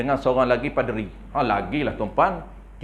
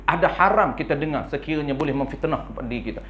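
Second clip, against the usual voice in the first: ordinary talk, nothing marked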